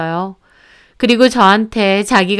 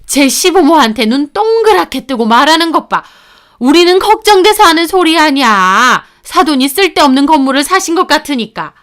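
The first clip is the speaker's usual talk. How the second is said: high, louder scolding in-law voice; self-righteous mother-in-law, pitched up; indignant mother-in-law; 5 semitones higher, 3 dB louder than usual. Acted. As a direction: protest as an offended mother-in-law. Mother-in-law snaps back in quarrel.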